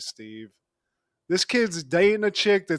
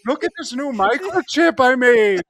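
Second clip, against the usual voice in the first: high, hammed-up Irish inventor; jokey Irish accent, bursting with pride; 7 semitones higher, 5 dB louder than usual. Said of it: "the Irish".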